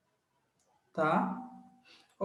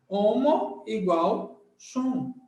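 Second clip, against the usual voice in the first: slow emphatic; much slower than usual. Shown as a demonstration. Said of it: "teaching emphasis".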